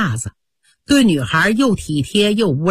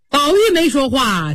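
plain reading voice

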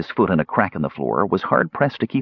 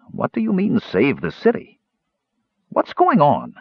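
pressing, insistent questions; demanding; 7 semitones higher than usual. A narrator's reading. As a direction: demand answers from a friend. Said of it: "the fighter demanded".

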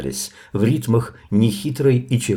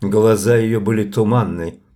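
plain reading voice

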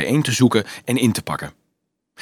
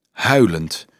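ordinary delivery, nothing marked

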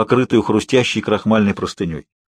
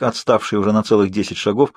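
ordinary delivery, nothing marked

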